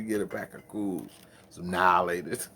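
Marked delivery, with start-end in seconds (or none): none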